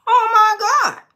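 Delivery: high, loud cry of dismay; exclaimed dismay. Real, not acted. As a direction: wail it out high in disbelief. Mock dismay at harsh card reading.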